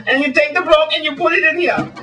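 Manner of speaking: high, hammed-up mock Swedish showman; Swedish magician. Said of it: "as the Swedish magician".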